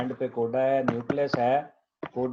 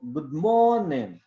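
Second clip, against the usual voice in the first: गुड मॉर्निंग merged in a native English accent, long drawn "mo-o", d swallowed; native-accent गुड मॉर्निंग with a stretched, rising-falling middle; 4 dB louder than usual. Shown as a demonstration. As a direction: imitate native English, run गुड मॉर्निंग together as "gu'mooornin". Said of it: "लेकिन गोरे को अगर आप सुने".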